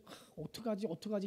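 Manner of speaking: soft mock fretting; a touch of retold panic; worried murmur. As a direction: fret under the breath. Retold worry at pregnancies.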